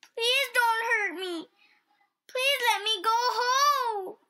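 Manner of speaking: high, loud, pleading wail; sobbing child. A narrator's reading. Unseen child begging to go home, sobbing. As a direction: beg in a wild child's shriek.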